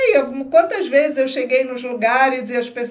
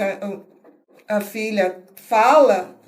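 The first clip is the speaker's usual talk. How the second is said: ordinary talk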